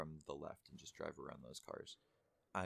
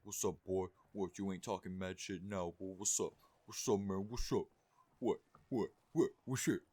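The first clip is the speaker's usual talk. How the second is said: loud, hammed-up confrontational taunts, climbing higher; mock aggressive douchebag; 4 semitones higher, 9 dB louder than usual. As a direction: fire off mock challenges, building to a high yell. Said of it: "and he's like".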